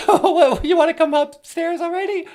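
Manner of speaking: high-pitched playful character voice; high squeaky voice. Pretend. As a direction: squeak it like a cartoon sidekick. Wife's high voice over intercom.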